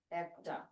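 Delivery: একটা said quietly as "æk-ta"; open-এ একটা. Shown as a demonstration. Teacher repeating the example of open pronunciation, soft "æk-ta" for একটা. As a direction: softly split একটা, first vowel open æ. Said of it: "এরকম উচ্চারণ করতেছি".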